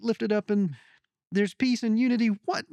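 excited. Retold or imitated. Mock outraged townsman voice.

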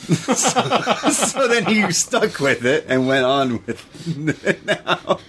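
high, drawn-out words through real laughter; laughing while talking. Real, not acted. Telling how a friend got renamed Gordon, laughing. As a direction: crack up and push the sentence through giggles.

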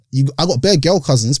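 usual speaking voice

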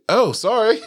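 high-pitched mock "oh, sorry"; imitated startled apology. Oblivious child answering the parent, high startled imitation.

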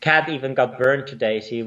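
usual speaking voice

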